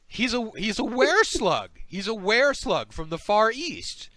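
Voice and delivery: high, rapid slug voice; protesting slug. Acted. Slug covering for its disguised companion, flustered denial. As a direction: cut in with a high, hasty protest. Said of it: "the other slug says".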